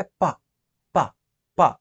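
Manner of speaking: ప as a sharp puff of air at the lips with no voice; unvoiced p burst. Shown as a demonstration. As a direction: pop ప from the lips and stop it. (ప ను పెదాల దగ్గర గాలి ఒక్కసారిగా పేల్చి, స్వరం లేకుండా)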